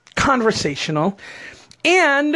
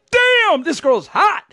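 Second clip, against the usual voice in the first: a higher, hammed-up burst on Damn; mock excitement; 8 semitones higher, slower than usual. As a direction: play the dazzled guy, tongue in cheek. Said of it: "It wasn't like I was like".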